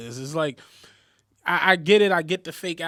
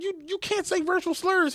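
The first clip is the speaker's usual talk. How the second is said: high-pitched mock scolding; shocked reprimand; 13 semitones higher than usual. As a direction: retell her appalled telling-off. Retold manager reprimanding employee.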